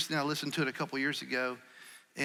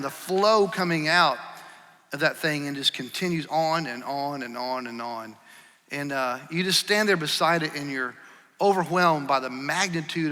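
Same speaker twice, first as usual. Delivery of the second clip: preacher building to a louder, fervent pitch; rising preaching; 4 dB louder than usual. Acted. Pastor urging the congregation, rising preaching swell. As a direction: press the point harder with each line, preacher's swell.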